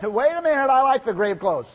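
high, loud, comic protesting voice; silly Lazarus. Pretend. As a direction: ham up a dead man who loves his grave clothes. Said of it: "What if Lazarus would have said".

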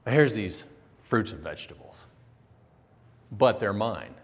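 lightly voiced possessive Cain; mock proud farmer showing off his harvest. Cain voiced offering to God.

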